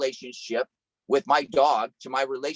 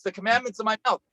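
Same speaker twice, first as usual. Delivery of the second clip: ordinary talk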